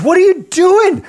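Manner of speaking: high-pitched, loud yell of disbelief, drawn out; outraged son. Retold or imitated. Son protesting to dad over burned cash, outraged yell. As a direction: act out a kid yelling in outrage. Son's outrage in retold story.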